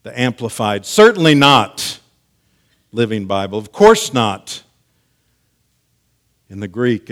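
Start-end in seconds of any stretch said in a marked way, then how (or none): 0.9-2.0 loud, raised "Certainly not"; emphatic answer. Acted. Preacher quoting Paul's answer in Amplified, emphatic "Certainly not". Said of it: "gives an emphatic answer". 3.6-4.6 loud, firm "Of course not"; emphatic answer. Acted. Preacher quoting Paul's answer in Living Bible, emphatic "Of course not". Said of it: "gives an emphatic answer".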